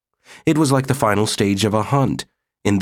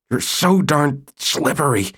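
strained; 7 semitones higher than usual. Acted. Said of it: "he managed to say".

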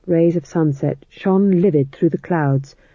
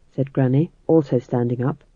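ordinary delivery

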